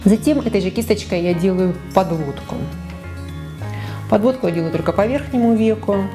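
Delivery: normal talking voice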